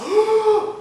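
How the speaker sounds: loud, high theatrical gasp; shocked gasp. Acted. Preacher mimics modern reaction to the Akedah, shocked gasp. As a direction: suck in air in exaggerated shock. Mock shock at child sacrifice.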